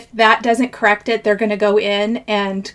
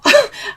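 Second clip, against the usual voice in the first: a quick high giggle; short high laugh; 13 semitones higher than usual. Real, not acted. Crafter laughs at the card that gave her trouble, short high giggle. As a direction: laugh it off lightly.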